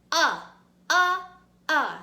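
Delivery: UH said loud as short U in up; short U sound. Shown as a demonstration. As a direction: punch out UH like the start of up. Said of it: "The short U says".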